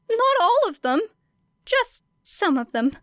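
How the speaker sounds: put-on high, shy, self-effacing boy's voice; bashful hero brushing off praise; high, modest, nervous boy. Midoriya answers, nervous.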